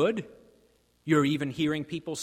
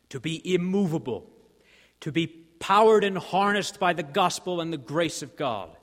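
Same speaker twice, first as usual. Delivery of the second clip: ringing exhortation; 4 semitones higher, 7 dB louder than usual. Real, not acted. Preacher swells on the exhortation.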